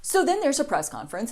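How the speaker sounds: normal talking voice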